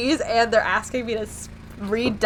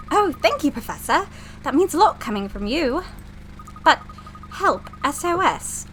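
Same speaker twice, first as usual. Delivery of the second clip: higher-pitched assistant with a put-on British accent; eager British assistant; 5 semitones higher than usual. Assistant thanks the professor for praise, British role voice. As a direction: play the keen young assistant in British English, grateful, then puzzled.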